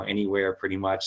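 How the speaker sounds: usual speaking voice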